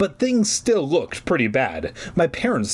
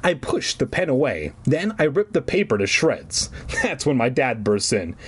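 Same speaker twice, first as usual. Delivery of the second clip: usual speaking voice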